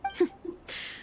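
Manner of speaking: quiet airy chuckle, almost no voice; soft breathy laugh. Acted. The Shogun quietly laughing to the traveler, soft breathy laugh. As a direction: let a small laugh slip out under the breath. Shogun amused after a hard talk.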